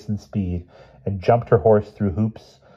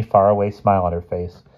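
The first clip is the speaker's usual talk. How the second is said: ordinary delivery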